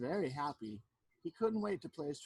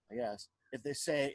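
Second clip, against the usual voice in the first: plain reading voice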